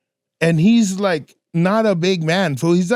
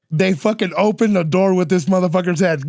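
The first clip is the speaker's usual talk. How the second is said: ordinary talk, nothing marked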